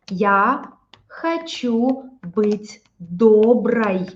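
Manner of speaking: я хочу быть доброй said very slowly; slow it right down to show the case; unhurried model sentence; slow demo. Shown as a demonstration. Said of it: "So when you are talking".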